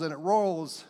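ordinary talk, nothing marked

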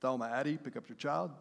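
a store clerk paging the mother over the loudspeaker; PA announcement. Retold or imitated. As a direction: page a parent over the intercom. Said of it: "through a P A system".

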